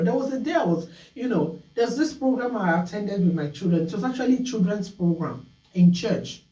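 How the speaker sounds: ordinary talk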